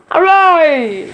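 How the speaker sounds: a high, happy cheer from a character; Doctor Moose cheering. Acted. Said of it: "says Doctor Moose".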